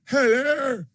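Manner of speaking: high, hammed-up British greeting; mock British accent. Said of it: "she has a British accent".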